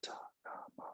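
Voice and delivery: unvoiced whisper of Satanama; whispered mantra. Shown as a demonstration. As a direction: breathe Satanama with no voice. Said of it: "we're gonna whisper it".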